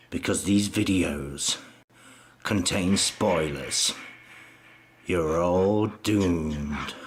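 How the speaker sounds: slow, low ominous drawl; ominous doom voice. Acted. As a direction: warn of doom, dragging each word. Ominous doom warning repeated.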